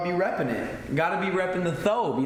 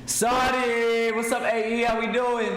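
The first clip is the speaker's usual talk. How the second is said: hyped greeting; 8 semitones higher than usual. Real, not acted. Streamer greeting Saudi viewers with an excited call.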